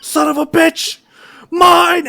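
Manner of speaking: very high, very loud furious shriek; raging scream. Acted. Goddess raging as the hero escapes her, screaming. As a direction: scream at losing your prize.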